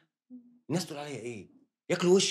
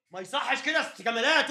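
ordinary talk, nothing marked

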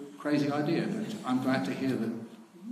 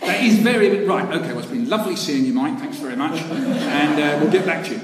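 mock eager agreement; 4 semitones higher, 6 dB louder than usual. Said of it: "I said".

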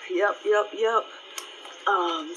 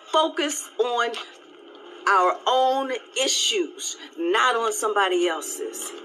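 slow it right down to drive it home; slow, emphatic repeat; slower than usual. Real, not acted. Repeating her point to viewers, slow and emphatic.

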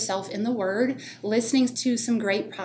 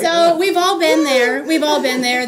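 louder reassurance; 6 semitones higher, 10 dB louder than usual. Real, not acted. Answering the laughing praise.

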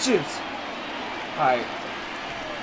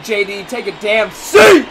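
triumphant shout; 4 semitones lower, slower than usual. Real, not acted. Gamer taunting J D after strikeout, triumphant shout.

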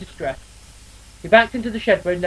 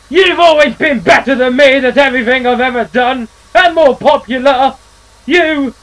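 much louder and higher, slowing into I hate you; furious outburst; 8 semitones higher, 10 dB louder, slower than usual. Acted. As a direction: scream your hurt at him. Eustace's furious outburst at brother.

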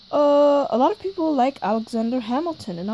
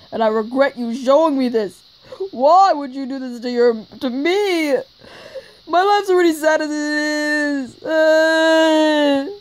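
louder, blaming her for the sad video; loud upset complaint; 5 semitones higher, 8 dB louder than usual. Acted. Stan complains to Mabel about showing him this, loud and upset. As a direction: let the hurt come out as a loud gripe.

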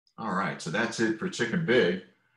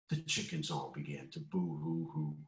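ordinary delivery

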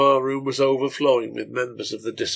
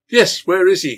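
higher, louder angry call; 7 semitones higher, 10 dB louder than usual. Acted. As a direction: shout it across the room. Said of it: "called out half a dozen others".

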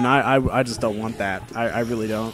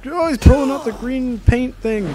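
alarmed; 11 semitones higher, 3 dB louder than usual. Real, not acted. Enemy pulls out weapon.

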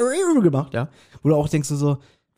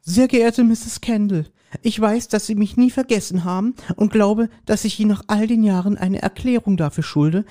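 higher, measured female reading voice; woman's voice; 5 semitones higher than usual. Acted. [name] reads Patricia's letter aloud in a woman's voice. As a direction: read the letter as the woman writer. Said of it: "du kriegst relativ passabel eine Frauenstimme hin".

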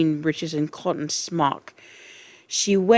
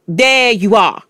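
loud, raised crow of triumph; triumphant cry; 4 semitones higher, 7 dB louder than usual. A narrator's reading. Trunchbull catching out Miss Honey, triumphant. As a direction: pounce on it as proof. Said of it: "cried the Trunchbull, triumphant".

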